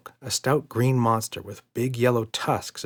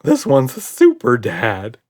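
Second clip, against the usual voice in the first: louder, slow trembling warble; warbling; 3 semitones higher, 10 dB louder, slower than usual. A narrator's reading. Red warns Roger, slow and warbling. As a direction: warble it nervously. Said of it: "warbled Red".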